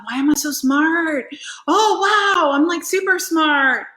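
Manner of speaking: high, loud self-admiring gush; mock amazement. Woman wonders at being so intelligent, mock amazed. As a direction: marvel theatrically at yourself. Acting out amazement at own cleverness.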